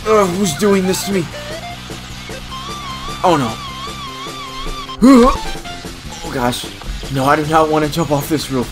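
high, loud panic building to a scream; panicked pleading. Acted. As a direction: plead in terror, then scream. Forced toward jumping off roof.

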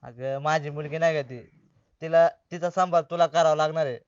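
low, soft and sorrowful; subdued, heavy-hearted request; grave, dejected plea. Acted. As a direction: entrust her as if time is short. Dejected man entrusts his daughter.

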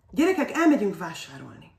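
insist, calling them again. A narrator's reading.

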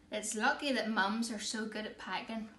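usual speaking voice

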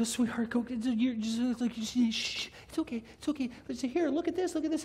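high, hushed shushing and coaxing; struggling parents. Retold or imitated. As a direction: imitate parents frantically soothing a toddler. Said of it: "So, but they go".

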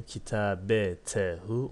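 Kitabetehu said slowly with each syllable clear; slow Arabic reading. Shown as a demonstration. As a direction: model Kitabetehu slowly for the class. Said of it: "Ve daha neyi inceleyelim?".

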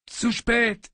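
breathless high gasp. A narrator's reading. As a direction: gasp it out in pain. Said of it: "japste ich". (atemlos japsend)

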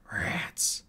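whispered, scornful single word; muttered in disgust. A narrator's reading. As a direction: breathe it out with distaste. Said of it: "he de-, de-muttered".

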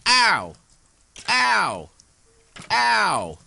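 loud, high "ow"; short high cries of pain; yelps of pain. Real, not acted. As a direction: yelp as each hit lands. Getting hit in the game.

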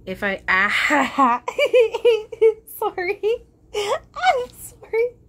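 overwhelmed, apologizing through tears; real emotion spilling over, laugh-crying; close to tears. Real, not acted. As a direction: voice breaking high between laugh and sob. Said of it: "I'd wanna cry right now".

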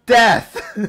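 Death! punched loud and high with a falling end; loud emphatic Death with a fall; stress on Death. Shown as a demonstration. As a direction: slam the word Death. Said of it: "Emphasis on death".

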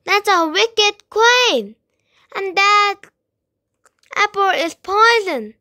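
loud, high urgent shouting; shouted warning. A narrator's reading. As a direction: shout out the danger. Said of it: "she shouted".

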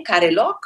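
usual speaking voice

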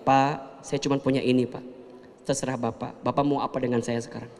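humble calm reply to a creditor; calm quiet talk. Shown as a demonstration. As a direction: settle the voice and speak gently. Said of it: "Jadi lebih tenang bicaranya".

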